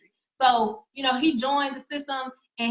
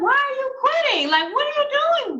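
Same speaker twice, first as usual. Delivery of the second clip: much higher, louder incredulous outcry; shocked disbelief; 12 semitones higher, 4 dB louder than usual. Retold or imitated. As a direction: squeal it like you can't believe he's quitting. Shocked reaction to quitting friend.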